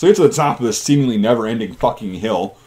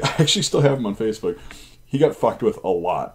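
ordinary talk

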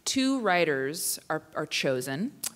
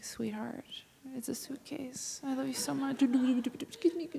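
whispered reply; 7 semitones higher, 6 dB quieter than usual. Retold or imitated. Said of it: "I'm like".

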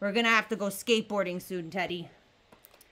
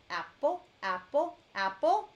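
ordinary delivery

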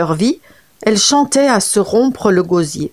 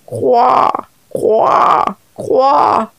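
higher croaks breaking into a cry; wailing croaks; 4 semitones higher, much slower than usual. Acted. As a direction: croak as if weeping. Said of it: "Les mêmes lamentables croassements". (coassements plaintifs et pleurés)

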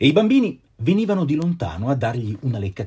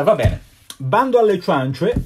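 plain reading voice

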